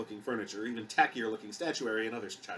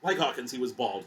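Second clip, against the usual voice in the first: plain reading voice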